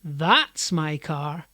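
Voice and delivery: slow, loud THAT'S, then a rising end; stress on THAT'S. Shown as a demonstration. Pointing to a different car, stress on "That's". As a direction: punch "That's" to pick one out. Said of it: "and point to a different car".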